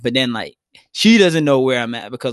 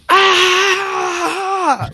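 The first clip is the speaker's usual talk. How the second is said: sudden high yell of shock and pain; pained scream; 3 dB louder than usual. Retold or imitated. Retelling being hit by a tree, a sharp scream. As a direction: cry out as the tree knocks you down.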